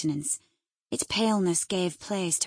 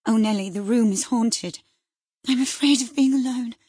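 louder, higher frightened plea; terrified cry; 4 semitones higher, 8 dB louder than usual. Acted. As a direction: beg not to be left alone. Catherine terrified of the haunted room.

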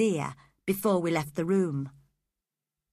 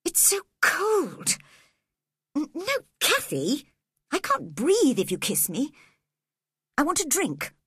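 put-on a feverish boy complaining; a touch of a sickly, whimpering boy; whiny sick boy; 7 semitones higher than usual.

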